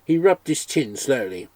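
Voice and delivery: ordinary delivery, nothing marked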